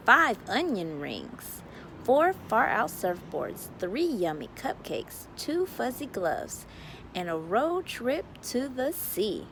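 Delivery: ordinary delivery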